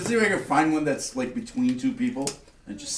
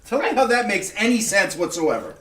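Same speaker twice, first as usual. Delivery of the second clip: incredulous, higher and louder; indignant; 7 semitones higher, 4 dB louder than usual. Acted. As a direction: demand answers, outraged. Character confronts attacker.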